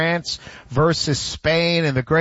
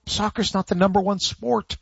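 ordinary delivery

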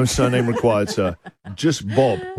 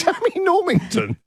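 laughing. Real, not acted. Laughing at odd name.

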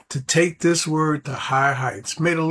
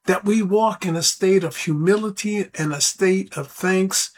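normal talking voice

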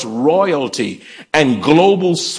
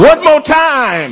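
high, loud, drawn-out call to the congregation; rally the crowd with a stretched shout; rousing shout; 10 semitones higher, 5 dB louder, slower than usual. Real, not acted. Urging congregation to repeat louder.

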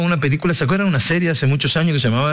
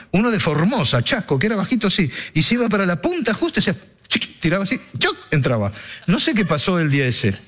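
normal talking voice

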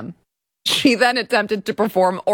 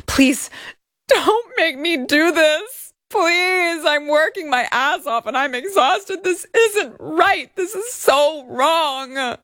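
high, slow whiny mock plea; mock pleading; 9 semitones higher, slower than usual. Reading the complaint, mocking the plaintiff begging Hajini. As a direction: beg in a hammed-up whimper. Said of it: "Plaintiff pleaded".

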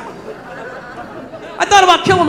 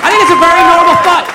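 raised, higher-pitched shout; loud insistence; 4 semitones higher, 6 dB louder than usual. Real, not acted. Comic insisting to the crowd on his view, loud. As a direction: shout it out like a proclamation. Defiant punchline, then said again calmly.

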